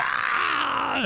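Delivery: very high pained cry of burning; mock pained yell. Cook retelling his first bite of habanero, yelping in pain. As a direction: yelp like the pepper just hit.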